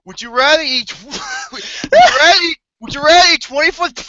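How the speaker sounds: breaking up with laughter, loud; laughing, crying voice. Real, not acted. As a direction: squeal it out through laughter. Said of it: "I can't even say it".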